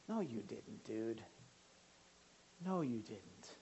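low, very soft deadpan denial; hushed reproof. Acted. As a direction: drop right down and quietly correct him. Pastor answers the confessing guy.

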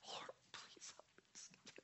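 slow, barely audible plea; desperate whisper. Acted. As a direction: whisper it slowly, begging. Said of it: "he's going through such desperate times".

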